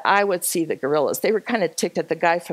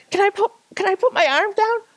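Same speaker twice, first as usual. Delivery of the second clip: high, quick mock plea; retold pleading; 13 semitones higher, faster than usual. Hiker to porter holding her arm, imitated pleading. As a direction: blurt it out in a high, beseeching voice.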